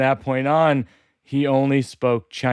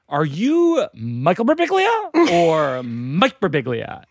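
slow, higher put-on computer voice; computer asking; 4 semitones higher, slower than usual. New laptop checking the user's identity, slow and deliberate. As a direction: stretch out each name as a machine's query. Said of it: "it's asking me".

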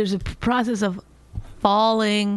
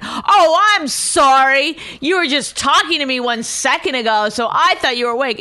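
loud, higher mock snippy apology; hammed-up angry husband; 6 semitones higher, 6 dB louder than usual. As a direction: imitate him getting mad and sarcastic. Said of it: "And then you got really mad".

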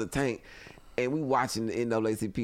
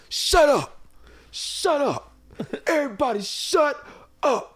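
angry yell; 13 semitones higher, slower than usual. Retold or imitated. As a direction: bark at the whole room to shut up. Brown storming in, yelling.

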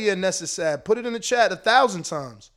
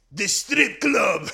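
drawn-out mock Russian accent; Hrgovic's Russian-sounding accent; much slower than usual. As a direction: stretch it slowly in a thick, comic Russian accent. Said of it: "he sounds Russian like a motherfucker".

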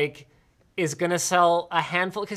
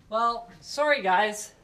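ordinary talk, nothing marked

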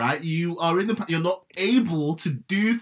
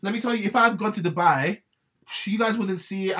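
ordinary talk, nothing marked

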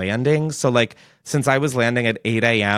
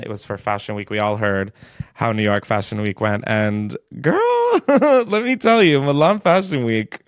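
ordinary talk

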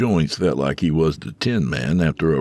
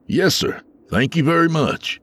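smiling thanks; 12 semitones higher than usual. A narrator's reading. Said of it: "Tony said with a smile".

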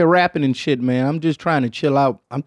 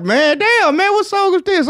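pleasantly surprised; 14 semitones higher, slower than usual. Retold or imitated. Man in an Uber hearing country music, surprised. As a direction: catch yourself loving a tune you hate.

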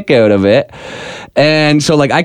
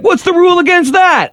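challenging the ref. Retold or imitated. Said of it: "I was like".

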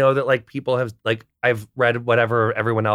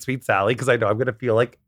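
ordinary delivery

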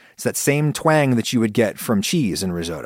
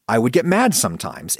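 normal talking voice

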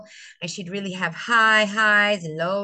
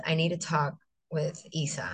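low, near-whispered request; hushed principal; 6 semitones lower, 6 dB quieter than usual. Retold or imitated. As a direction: quote the principal softly and discreetly. Principal breaking bad news discreetly.

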